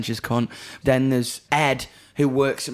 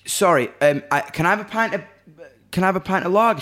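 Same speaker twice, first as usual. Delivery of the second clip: pleading pint request; 5 semitones higher, 4 dB louder, faster than usual. Retold or imitated. Asking the waitress for lager.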